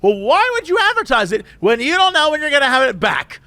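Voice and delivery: high-pitched, outraged customer; indignant Karen. Retold or imitated. Woman complaining to drive-through cashier, indignant. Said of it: "she's going full Karen".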